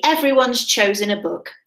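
ordinary delivery, nothing marked

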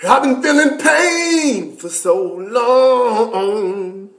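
mock-dramatic wail of pain; drawn-out pain. To viewers about his pain, a slow hammed-up lament.

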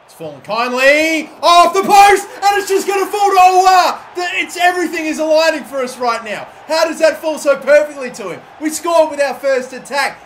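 yelling in real excitement as the ball falls his way; excited shout. Real, not acted. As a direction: shout it, the goal is coming. Goal chance in FIFA match.

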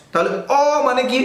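normal talking voice

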